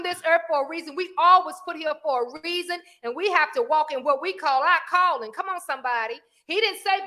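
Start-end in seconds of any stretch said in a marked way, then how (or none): none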